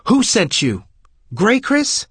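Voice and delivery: loud, rising furious shout. Acted. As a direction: yell it in a rage. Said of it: "Her voice rough and deep with fury, Abena shouted".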